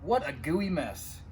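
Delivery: usual speaking voice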